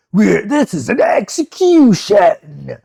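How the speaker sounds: high, loud, drawn-out anime declaration; mock outraged shout. Retelling the soldier's answer, mock shouting. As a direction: bellow it slowly, hammed right up. Acts out character's outburst.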